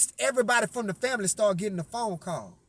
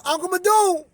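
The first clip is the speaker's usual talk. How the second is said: higher, louder call through the trailer; 7 semitones higher, 3 dB louder than usual. Retold or imitated. Nephew calling his uncle inside the trailer, raised call. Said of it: "I yell".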